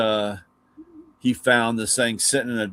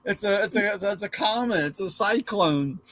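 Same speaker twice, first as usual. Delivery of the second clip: put-on high, stammering boy pleading with dad; pleading kid voice; 9 semitones higher than usual.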